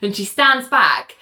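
usual speaking voice

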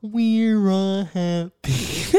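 very slow, high sung character voice; Barney. Acted. Doing a requested impression for the cohost, sung dinosaur voice. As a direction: croon it like a children's TV dinosaur. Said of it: "Can you do an impression of Barney?".

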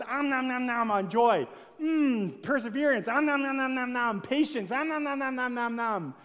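greedy om nom chewing between the words; munching noises. Pretend. Believer feasting on the fruits of the Spirit, greedy munching. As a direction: devour the virtues like a treat. Mimes eating joy, patience.

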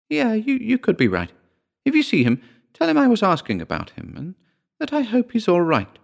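very high-pitched, timid auntie voice; high, hesitant older relative. A narrator's reading. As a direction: voice a concerned aunt, pitched far up.

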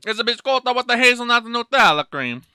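high, loud, over-the-top Italian; mock Italian accent. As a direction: proclaim it like a hammy Italian. Repeats the Italian bit, bigger.

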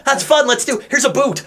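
high, bouncy, delighted voice; gleeful kender. Pretend. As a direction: chirp it like an excited kid. Voicing Taz, gleeful.

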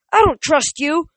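high, loud defiance; angry shout. A narrator's reading. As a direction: hurl distrust back at him. Nico screams back mid-attack.

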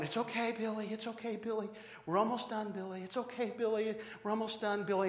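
put-on soft, soothing dad to a screaming boy; quietly calming a child in a cart; calm reassurance.

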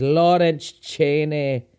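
a hint of Scottish accent on Lawrence Chaney, mimicking RuPaul. Shown as a demonstration. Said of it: "as good of a Scottish accent as I can do".

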